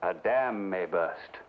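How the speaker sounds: put-on an Englishman's accent, unhurried and proper; English accent. Sir Richard dismissing his hired men, English accent. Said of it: "That's the worst accent I ever heard".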